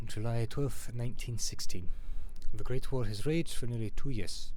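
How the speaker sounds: put-on loud French accent slipping toward German; botched French accent. Game player narrating the war intro, botched French accent. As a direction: attempt French, landing nearer German. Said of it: "I think that was more German".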